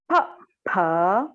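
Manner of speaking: pa popped from the lips; teacher demo of pa; lip sound pa. Shown as a demonstration. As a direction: model pa with clear lips. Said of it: "to do with the lips".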